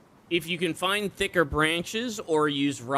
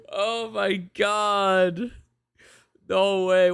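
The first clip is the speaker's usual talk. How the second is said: ordinary talk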